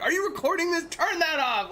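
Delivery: high, loud mock outrage; retold angry yell. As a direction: re-enact a furious dad yelling from the background. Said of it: "Like, I'm so mad".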